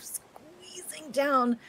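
drawn out, high squeezing voice; strained squeeze. Acted. Game master narrating a big man down the ladder, strained squeeze. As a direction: stretch the word as if wedging through.

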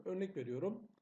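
ordinary talk

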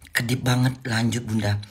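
ordinary delivery

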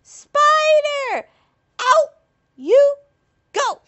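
high furious shriek; berserk rage. Acted. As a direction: yell it out of the house. Family throws the spider out.

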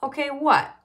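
high, loud query; loud questioning. A narrator's reading. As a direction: ask it loudly for all to hear. Carla loudly questions whispering Buster.

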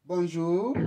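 teacher greets, inviting the learner's turn. Shown as a demonstration. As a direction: greet them and wait for the reply. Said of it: "ni zamu yako".

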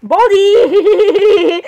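high, loud shout of Baldi that breaks into a laugh; wild laughing yell. Real, not acted. Laughing at the game, yelling Baldi's name with a cackle. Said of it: "what kind of a laugh was that?".

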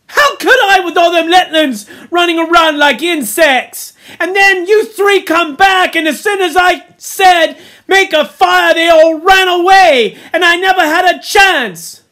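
high, loud defensive rant; Piggy, indignant. A narrator's reading. Piggy defends himself over the scattered children, indignant shouting. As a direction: protest at full volume. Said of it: "cried Piggy indignantly".

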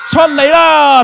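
very high, loud shout; summoning shout. Pretend. Magician and children summon the chicken, full loud shout. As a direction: belt it out on the count. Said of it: "大聲啲".

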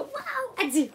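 squealed high wows and delighted gasps; peekaboo baby talk. Acted. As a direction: play peekaboo with a baby, all wonder. Baby game continues.

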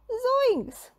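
high startled yelp; shocked exclamation; Zoinks cry. A narrator's reading. Shaggy opening the empty oven, high and shocked. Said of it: "cried Shaggy".

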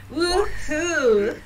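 high, loud laughing woohoo; giddy laughing cheer; excited whoop. Real, not acted. Sims about to woohoo.

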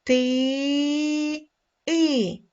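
ти with its vowel held long, then the vowel alone; drawn-out vowel. Shown as a demonstration. Teacher isolating the second sound, long vowel in ти. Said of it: "Другий звук".

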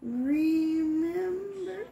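high, drawn-out voice through static; radio tuning in. Real, not acted. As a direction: spirit box radio voice. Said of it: "That was a little girl's voice".

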